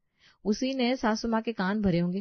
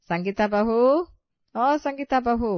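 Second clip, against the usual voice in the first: louder call across the house; 6 semitones higher, 6 dB louder than usual. Acted. Mother-in-law calling her daughter-in-law from afar, loud. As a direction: call her name from another room. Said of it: "सास की आवाज आती है".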